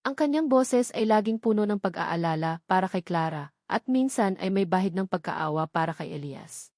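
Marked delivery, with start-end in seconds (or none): none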